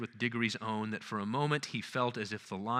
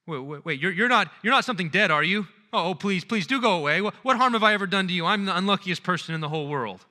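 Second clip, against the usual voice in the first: high, loud and fast, panicked begging; a scared boy begging the dark voice away; terrified pleading; 8 semitones higher, 9 dB louder, faster than usual. Acted.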